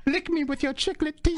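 high, laughing put-on voice; goofy character voice. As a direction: ham up a lecherous creep. Same character continues.

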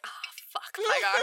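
whispered oh fuck, then high Pygar; whispered curse. Retold or imitated. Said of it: "she's like".

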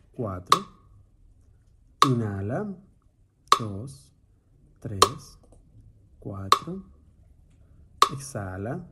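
soft, slow counting of breaths, drawn-out rising ends; guided breath counting. Acted. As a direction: count the breath gently for a meditation. Cuenta respiraciones en meditación. (conteo de respiración suave y pausado)

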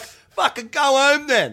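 high, loud, drawn-out retort; slow, shouted dismissal; retold angry yell. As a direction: imitate a boss shouting back on site. Retold shouting match with boss.

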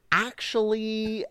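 put-on high, drawn-out toddler "actually"; Rowan. Dad mimics his two-year-old's filler word, drawn-out toddler voice. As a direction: stretch it out slowly and high. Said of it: "Rowan has started going".

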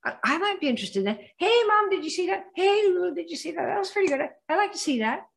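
high, eager and thrilled; excited prospect. Retold or imitated. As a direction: call out to family, thrilled. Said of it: "ones that are".

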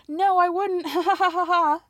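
high, loud cackling mimic of her; mock her with a big high laugh; mocking mean-girl laugh. Mimicking the ex-wife laughing at him, high and loud. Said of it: "she's like".

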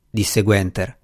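plain reading voice

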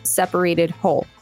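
normal talking voice

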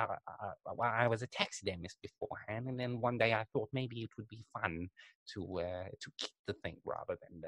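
a hint of a rambling old Englishman; old British taxidermist. Imitating the taxidermist on the documentary, soft and rambling. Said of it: "he speaks like this".

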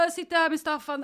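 a hammed-up husband brushing her off; imitating husband.

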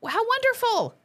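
high, loud mock gush; a big breathless fan gush. Her gushing reaction.

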